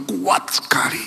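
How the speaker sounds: hushed, awed whisper. Real, not acted. Preacher telling the congregation about the steadfast woman, awed whisper. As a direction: breathe it like a secret you marvel at.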